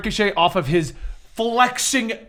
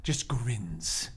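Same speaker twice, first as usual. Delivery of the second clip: much lower and softer, sinister; low evil narration; 9 semitones lower, 15 dB quieter, slower than usual. A narrator's reading. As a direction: drop the voice to a menacing hush. Narrating Batman's evil grin.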